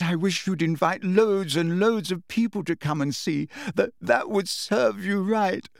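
high, tearful rant; sobbing. A narrator's reading. Hermione lashing out at Umbridge, sobbing. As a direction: spite through tears, stumbling on words. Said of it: "now sobbing into her hands again".

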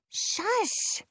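high sharp shush; Penny shushing. A narrator's reading. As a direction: hush them, high and quick. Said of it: "Penny says".

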